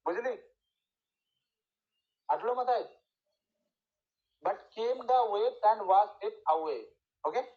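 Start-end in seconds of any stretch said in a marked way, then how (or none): 4.4-6.8 very slow metrical reading, beat by beat; slow demonstration of the verse rhythm; scanned stresses. Shown as a demonstration. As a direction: draw out the line foot by foot, pausing on the stresses. Teacher scans next line of sonnet.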